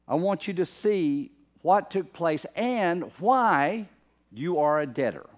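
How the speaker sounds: slow, deliberate, weighty preaching; emphatic appeal. Acted. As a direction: stretch out each phrase so it lands. Voices Paul's urgent appeal, slowly.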